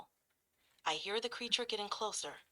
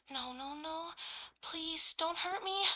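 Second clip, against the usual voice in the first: softly pleading, higher pitch; nervous whisper; 7 semitones higher, 3 dB quieter than usual. Acted. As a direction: beg quietly in fear. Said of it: "Panting and whispering nervously".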